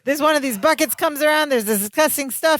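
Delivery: Balky. Retold or imitated. Balky explaining the stunt to his cousin, excited. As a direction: tumble through it in mock excitement. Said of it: "Balky gets all excited".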